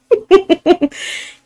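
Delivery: loud high laugh; nervous burst of laughter. Real, not acted. Nervous laugh at own claim.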